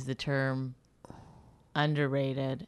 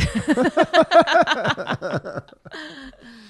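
bursting out laughing; big high laugh; loud laugh; 9 semitones higher, 7 dB louder than usual. Real, not acted. Laughs at the overrated joke.

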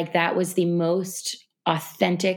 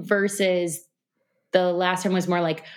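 normal talking voice

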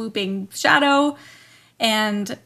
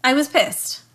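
higher, louder indignant statement; emphatic anger; 5 semitones higher, 5 dB louder than usual. Real, not acted. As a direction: hit it hard, genuinely annoyed. Angry emphasis, then repeated softer.

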